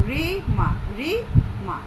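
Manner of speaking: রি মা with a clear pause between রি and মা; syllables apart. Shown as a demonstration. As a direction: tap out রি then মা, separated. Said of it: "এখানে দুটি অক্ষর".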